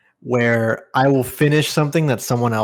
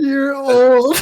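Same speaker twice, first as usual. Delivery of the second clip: mocking the girl; 14 semitones higher, 5 dB louder than usual. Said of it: "quoting the girl".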